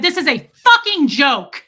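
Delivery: retold angry yell, leaning hard on the swearing; public outburst. Customer making a scene at the door, angry yell. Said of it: "I just did that out loud".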